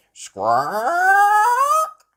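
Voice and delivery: long, loud, high parrot squawk. Acted. Polly about to recite the list, loud squawk. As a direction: screech like a parrot. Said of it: "Polly Parrot opened her beak".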